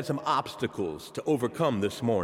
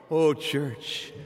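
ordinary talk

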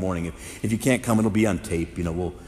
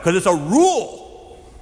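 mom's curt rule; 7 semitones higher, 8 dB louder, slower than usual. Retold or imitated. Mother's curt answer.

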